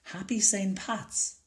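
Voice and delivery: put-on a little boy wishing a happy holiday; cheery boy greeting. A narrator's reading. Jamie greets the henman.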